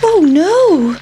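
high, drawn-out dismayed cry; alarmed pancake. A narrator's reading. Pancake learns it will be eaten, alarmed. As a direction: wail it with storybook alarm.

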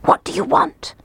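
hushed, frightened question; fearful whisper. A narrator's reading. Mayor opening his door fearfully to the piper, frightened whisper. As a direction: whisper it warily through the door. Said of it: "he whispered".

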